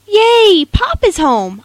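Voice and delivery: put-on high, loud, joyful child's shout; excited shout. Little girl cheering as her dad pulls up, loud and high. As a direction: cheer out loud that Pop is home. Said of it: "I shouted in my head".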